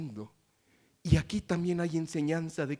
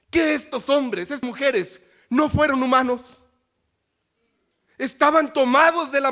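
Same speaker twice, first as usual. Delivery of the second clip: loud, higher preaching voice building in fervor; rising pulpit fervor; fervent preaching; 9 semitones higher, 10 dB louder than usual. Acted. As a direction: preach it out to the congregation. Preacher questioning congregation.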